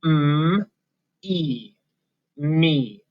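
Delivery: "M" as a held hum, "E" as long ee, pause, then blend "me"; sounding out. Shown as a demonstration. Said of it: "listen to what it sounds like".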